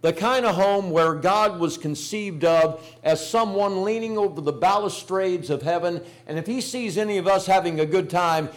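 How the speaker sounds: usual speaking voice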